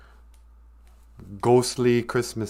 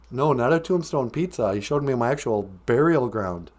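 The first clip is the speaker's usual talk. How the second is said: usual speaking voice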